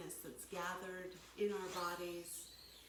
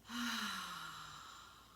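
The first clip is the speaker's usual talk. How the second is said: long, audible exhale through an open mouth; letting-go sigh. Shown as a demonstration. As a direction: sigh it all out to show the out-breath. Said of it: "really let go here".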